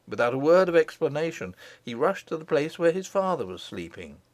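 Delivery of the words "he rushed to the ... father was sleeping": plain reading voice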